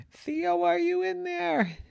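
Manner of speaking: very high, timid calling; scared mom cautiously calling into a room; still scared. Acted. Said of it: "She was still scared".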